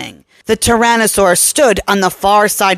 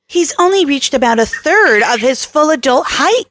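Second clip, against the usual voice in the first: higher woman's voice for Kelly; Kelly voiced; 7 semitones higher than usual. A narrator's reading. Kelly warning Bradshaw about the Tyrannosaur, higher voice. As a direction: give Kelly a raised, lighter register.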